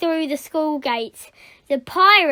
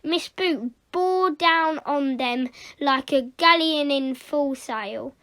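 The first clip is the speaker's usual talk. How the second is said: ordinary delivery, nothing marked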